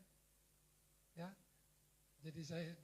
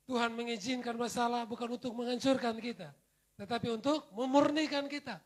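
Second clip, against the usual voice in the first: loud, rising sermon climax; full-force preaching; 5 semitones higher, 11 dB louder than usual. Acted. Pastor urges the congregation, loud fervent preaching. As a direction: preach it out to the hall.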